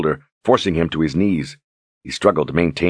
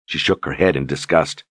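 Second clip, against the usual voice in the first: plain reading voice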